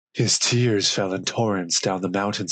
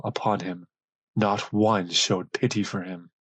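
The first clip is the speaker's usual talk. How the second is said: plain reading voice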